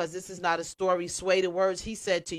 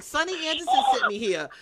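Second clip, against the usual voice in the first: imitating fans; 11 semitones higher, 5 dB louder, faster than usual. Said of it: "They're like".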